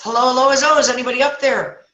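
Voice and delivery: high, loud acted hollering; nervous call for help. Nervous trapped man calls to footsteps above, acted cry. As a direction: call up hopefully from below. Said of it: "starting to get nervous".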